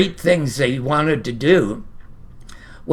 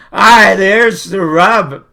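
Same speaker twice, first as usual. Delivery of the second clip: slow and louder, every word weighed; emphatic soliloquy; 5 dB louder, slower than usual. Shown as a demonstration. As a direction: drive the point home to the audience. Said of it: "And here it is".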